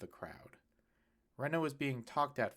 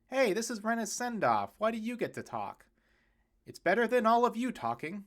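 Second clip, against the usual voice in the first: high, loud teasing banter; family members bickering at a send-off; heckling family; 10 semitones higher, 7 dB louder than usual. Acted. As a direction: rib each other across the porch. Bickering crowd on porch.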